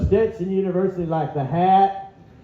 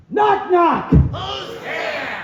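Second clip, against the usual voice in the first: Gilbert Gottfried impression; 8 semitones higher, much slower than usual. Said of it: "This is Gilbert doing a knock-knock joke".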